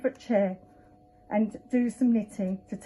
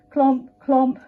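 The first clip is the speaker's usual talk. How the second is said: high punchy clomps; clomping stick; 3 semitones higher, 7 dB louder than usual. Acted. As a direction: knock each clomp like a wooden stick. Stick sound effect.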